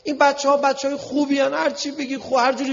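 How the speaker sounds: very high, loud, impassioned appeal; strained-high oratory at full force; heated preaching. Real, not acted. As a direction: cry out the argument to the crowd.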